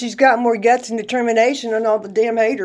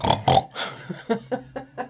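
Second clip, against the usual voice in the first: soft laugh; 6 dB quieter than usual. Real, not acted. Laughs at her reply.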